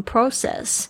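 process with a British long O, PROH-sess; British-accented process, first vowel as in "go"; British pronunciation. Shown as a demonstration. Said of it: "英式发音是念process".

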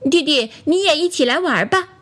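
put-on high, childlike invitation; inviting toy friends. A narrator's reading. Toys ask doll to join handkerchief game, light childlike invitation. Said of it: "对弟弟说".

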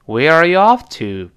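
WHERE ARE YOU OFF climbs high and loud, "to" drops; rising then falling tune. Shown as a demonstration. Teacher models the question for students, rising then falling tune. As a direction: let OFF soar, then let "to" fall. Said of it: "一直往上升".

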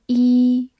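"E" held as a long "ee" vowel. Shown as a demonstration. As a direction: draw out "E" as a long, steady ee. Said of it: "发长音E". (把E拖长，读成长音“衣”)

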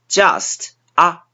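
just said with a wide-open "ah" vowel, the common mistake; 错误发音示范; wrong vowel demo. Shown as a demonstration. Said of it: "很多中国人会把这个音发成".

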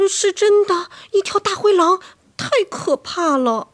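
high, loud, insistent alarm; high-pitched fearful pleading; frightened child. Acted. Frightened child insists wolf is real.

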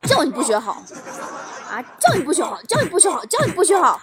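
loud, punched-out mock tirade; angry dad scolding. Dad thrashes his naughty child, acted angry shouting. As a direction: hammer each repeat like a blow. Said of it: "接着一通海揍".

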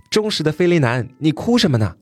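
mild calling out. Acted. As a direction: call out lightly to someone crying. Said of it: "背后有人在喊".

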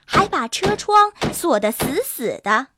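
usual speaking voice

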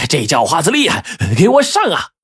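high, strained yelp for backup; hammed-up howl, pitch leaping at the end; pained squeal. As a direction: cry out like a pig being slaughtered. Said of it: "杀猪般的叫道".

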